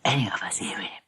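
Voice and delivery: hushed unvoiced whisper. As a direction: breathe it as if dogs could hear. Dog sneaking in, told in hush.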